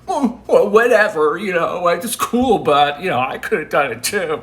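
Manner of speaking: loud, high-pitched mock shrug; acted-out grudging dismissal, starting loud and easing down; dismissive whatever. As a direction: brush it off with audible envy. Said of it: "feeling of, like".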